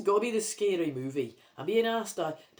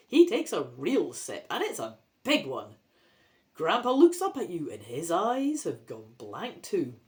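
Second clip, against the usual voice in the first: usual speaking voice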